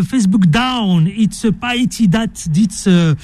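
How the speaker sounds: put-on slow English read with a heavy French accent; thick French accent in English. Host quoting Attali's English post, in a heavy French accent. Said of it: "avec mon accent mortel".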